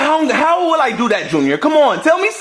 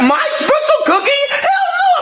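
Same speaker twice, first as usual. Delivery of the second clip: indignant outburst; 11 semitones higher than usual. Acted.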